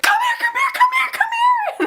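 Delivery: very high, squealed mock yell; retold squealing call to come over; frantic calling out.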